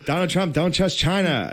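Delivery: high Irish-accent impression; high, hammed-up Irish accent from the remix videos; mock Irish accent. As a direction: ham up the viral Irish man. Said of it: "remixes of that guy, like, singing".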